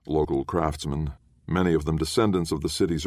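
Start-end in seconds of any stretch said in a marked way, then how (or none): none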